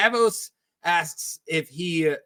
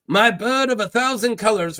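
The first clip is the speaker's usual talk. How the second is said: put-on pirate accent, pitched up; Salladhor Saan; 5 semitones higher than usual. Pirate tells Davos of his ship, in an accent. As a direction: exotic accent of a boastful captain. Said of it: "what am I doing with this accent".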